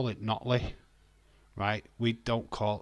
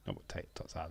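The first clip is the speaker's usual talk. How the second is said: hushed under-breath correction; whispered aside; 5 semitones lower, 13 dB quieter, much faster than usual. Real, not acted. Streamer whispering to herself about tater tots. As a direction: say it barely voiced. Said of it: "quiet aside".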